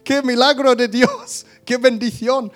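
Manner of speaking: high squeal, then slow, beaming exclamations; delighted shriek of good news; overjoyed outburst. Retold or imitated. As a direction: relive the thrill of a surprise windfall. Retelling joy at bank news.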